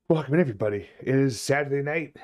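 ordinary delivery

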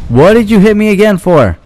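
high, loud outraged protest; indignant yell. Real, not acted. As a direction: yell it in disbelief. Indignant outburst at enemy.

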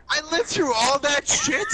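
usual speaking voice

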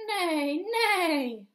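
high loud neighing horse. Acted. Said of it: "said the horse".

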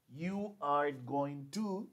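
very slow, each word spaced; very slow long form. Shown as a demonstration. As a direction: spell out the full form. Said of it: "Aquí yo digo".

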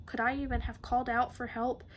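ordinary delivery, nothing marked

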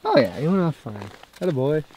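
normal talking voice

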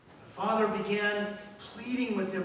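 normal talking voice

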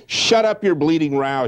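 snapping at the singer, lightly voiced; mild mock shout. Man of fifty silencing the singer, mild shout. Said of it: "shouts a man of fifty".

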